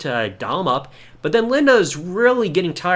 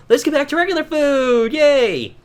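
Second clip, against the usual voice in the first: high, bright mock celebration; a hammed-up family cheer; 9 semitones higher, 4 dB louder than usual. Family cheering in retold scene.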